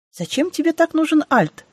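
high-pitched, head-on challenge; blunt question. A narrator's reading. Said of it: "спросила в лоб".

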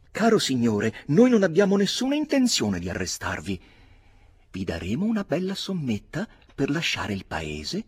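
voce acuta e forte, impaurita; si affretta a rassicurare; l'avvocato. A narrator's reading. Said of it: "disse l'avvocato". (l'avvocato rassicura Barry, acuto e nervoso)

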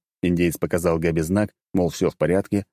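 usual speaking voice

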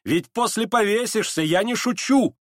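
high, urgent cry; shouted warning. A narrator's reading. Настасья Филипповна warns him she is not joking, shouting. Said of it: "кричала ему Настасья Филипповна".

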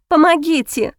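desperate sobbing cry; sobbing plea. A narrator's reading. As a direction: sob out the cry for help. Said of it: "всхлипнула я". (всхлипывая)

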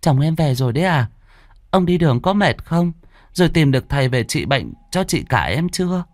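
high, sugary put-on sweetness to her husband; falsely sweet welcome. A narrator's reading. Concubine greets husband home, falsely sweet and high. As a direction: fake a loving wife's welcome. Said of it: "nét mặt giả dối".

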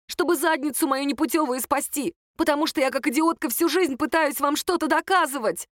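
high, agitated self-reproach; worked-up, exasperated confession; bitter outburst. A narrator's reading. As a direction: let the frustration pour out. Sister's confession to family escalates.

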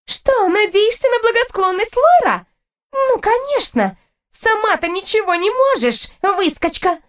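high, sneering taunt; scornful high-pitched girl; haughty rival girl, scornful. Acted. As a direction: taunt her in a high, contemptuous voice.

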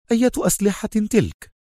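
high-pitched puzzled question; surprised Khaled. A narrator's reading. Khaled questions Adham about weapons, surprised. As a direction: ask astonished, pitch jumping up. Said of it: "سأله خالد في دهشة".

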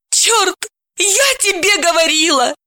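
high, sharp exclamation of annoyance; cursing in vexation; vexed exclamation. A narrator's reading. Dalila, caught with husband, cursing in vexation. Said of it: "с досадой воскликнула".

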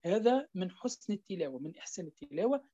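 usual speaking voice

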